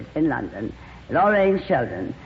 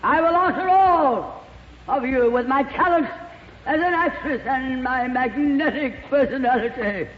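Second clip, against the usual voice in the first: grand actress declaiming; 8 semitones higher than usual. Pretend. Actress showing off her acting to the cast, grandly declaimed. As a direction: declaim it like a diva proving her talent. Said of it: "This will show you whether I can act or not".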